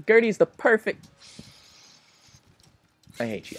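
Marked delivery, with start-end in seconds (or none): 3.2-3.6 muttered. Real, not acted. Muttered at boss.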